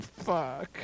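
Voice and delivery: hushed, dismayed swear; whispered curse. Retold or imitated. Customer out of ones, cornered by dancer, whispered curse. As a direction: swear softly under your breath. Dismayed reaction to tip demand.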